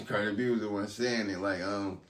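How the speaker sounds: ordinary talk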